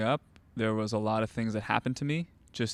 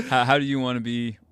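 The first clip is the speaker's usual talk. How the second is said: laughing; 2 semitones higher, 3 dB louder than usual. Real, not acted. Asks while laughing at himself.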